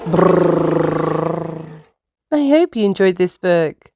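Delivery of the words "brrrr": lip trill. Acted. Narrator makes noise.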